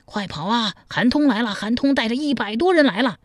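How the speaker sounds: high-pitched urgent warning cry; alarmed shouting. Acted. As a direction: raise the alarm, pitched well up. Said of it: "忽听有人喊道".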